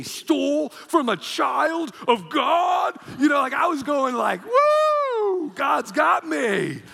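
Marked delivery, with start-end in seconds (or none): 0.0-3.0 loud, high, slow proclamation; booming declaration. Retold or imitated. Pastor telling his wife, slow loud high vow. Vowing God will defend him. 4.4-6.8 high loud whoop, then slow shout; excited whoop. Retold or imitated. Pastor cheering God's defense, loud high whoop.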